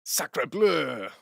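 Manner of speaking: a mock Quebecois "sacre bleu", lightly hammed; Quebecois accent. Streamer trying a second Quebec phrase for chat, in a mock Quebecois accent. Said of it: "my Quebecois voice".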